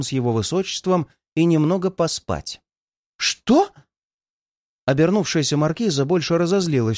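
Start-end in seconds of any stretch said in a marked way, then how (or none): 3.2-3.7 резкий высокий вскрик удивления; взвизгни от неожиданности; изумлённо. A narrator's reading. Маркиза резко оборачивается. (высокое изумлённое «Что?»)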